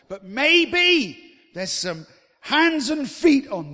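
slow, high and loud, each word leaned on; drawn-out climactic preaching line; emphatic preaching. Real, not acted. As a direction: hammer the point home slowly.

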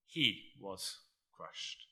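slow, hushed, weighty delivery; solemn slow. Real, not acted. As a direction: let each word sink in slowly. Solemn slowed declaration.

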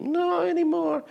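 high falling sigh on not anymore; mock mournful not anymore; wistful not anymore. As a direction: sing it out, sadly high then dropping. Mock lament, pub gone.

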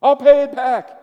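high, loud begging servant voice; pleading servant. Acted. Preacher voicing the servant begging his master, high and loud. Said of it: "don't I sound like the servant".

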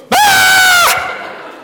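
high sudden shriek of alarm; mock panicked scream from the passenger seat; wife's startled scream. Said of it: "she'd go".

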